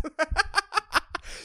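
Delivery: soft, high run of giggles. Real, not acted. Podcaster amused by his late-night complaint, high giggling. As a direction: crack up at your own rant. Laughs at his own rant.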